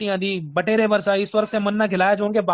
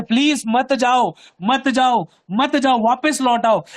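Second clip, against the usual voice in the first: slow, loud, higher-pitched begging; acted pleading; 5 semitones higher, 5 dB louder, much slower than usual. Preacher voicing Moses and Aaron begging people to return, pleading. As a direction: implore them, stretching each plea. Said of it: "उनके आगे घुटने टिकाए थे".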